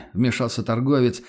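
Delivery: plain reading voice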